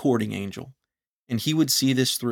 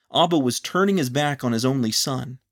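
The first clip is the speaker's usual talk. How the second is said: usual speaking voice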